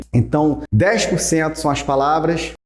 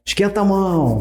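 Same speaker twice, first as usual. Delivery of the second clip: calling out instruction; 7 semitones higher than usual. Retold or imitated. Acting out instructions to audience.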